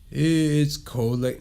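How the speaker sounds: drawn-out, slow first word; trying it catchier. Acted. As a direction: stretch out "It's" while testing the line. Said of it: "How can I say this".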